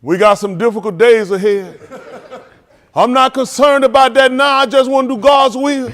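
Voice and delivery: loud, high preacher-style oratory; impassioned speech. Retold or imitated. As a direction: belt it out like a mock sermon. Acting out a famous speech tape.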